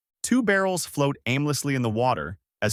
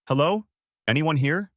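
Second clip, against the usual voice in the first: plain reading voice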